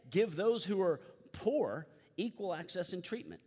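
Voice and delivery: high, loud prophetic exhortation; prophets' urgent call. Acted. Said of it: "God sent prophets to say over and over".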